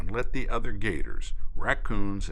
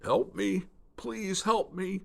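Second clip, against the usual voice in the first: high, strained croak begging for help; croaking plea; 12 semitones higher, 4 dB quieter than usual. Acted. Wounded alligator croaks to the spoonbill for help. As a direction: beg weakly like a wounded gator. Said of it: "He croaked".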